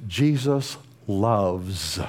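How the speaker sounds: slow tender quote. Acted. Quotes the Spirit, lingering.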